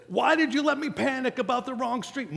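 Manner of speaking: high, whining complaint; high mock whiny mother; whiny mom. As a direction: whine like a mom who overreacted. Mom complaining after panic.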